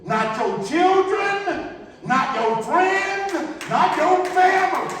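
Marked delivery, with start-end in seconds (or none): none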